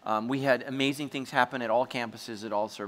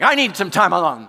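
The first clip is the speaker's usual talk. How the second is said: loud, high mock huff; heated outburst; 5 semitones higher, 10 dB louder than usual. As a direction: snap it and storm off. Retelling his angry walk-off.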